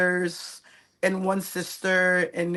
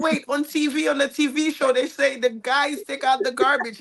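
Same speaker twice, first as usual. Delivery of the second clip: put-on protesting kid; 7 semitones higher, 5 dB louder than usual. Child arguing with dad.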